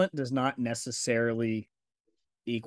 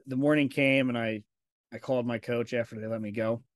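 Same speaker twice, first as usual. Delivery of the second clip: normal talking voice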